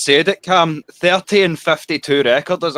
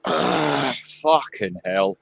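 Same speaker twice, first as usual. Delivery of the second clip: dejected groan; 5 semitones lower, 6 dB quieter, much slower than usual. Real, not acted. Fan despairs at the score.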